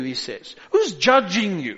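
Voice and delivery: ordinary talk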